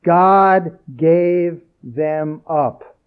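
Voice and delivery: "God gave them up." spoken very slowly with a heavy stress on "God"; slow emphasis. Shown as a demonstration. Preacher repeating the verse for emphasis, very slow. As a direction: repeat it slow and grave, word by word. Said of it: "uh, emphasis".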